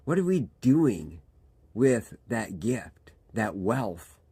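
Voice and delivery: ordinary talk, nothing marked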